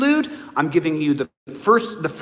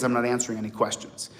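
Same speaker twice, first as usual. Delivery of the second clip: calm firm refusal; 8 semitones lower, 8 dB quieter, faster than usual. Shown as a demonstration. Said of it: "What you're supposed to say is".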